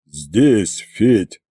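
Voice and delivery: low, weary dragon on the line; tired voice. A narrator's reading. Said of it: "раздался усталый голос".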